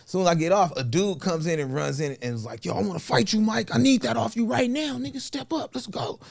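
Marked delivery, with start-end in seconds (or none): 2.7-6.2 higher-pitched fighting talk; acted challenge. Dude challenging Mike to a fight, acted challenge. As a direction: call him out, voice pitched up.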